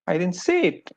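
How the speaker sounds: explanatory emphasis on "say"; stress on SAY. Shown as a demonstration. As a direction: SAY picked out above the other words. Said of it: "The emphasis of the word".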